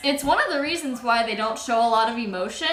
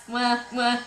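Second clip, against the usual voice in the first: between kisses. Real, not acted. Blowing kisses to cats.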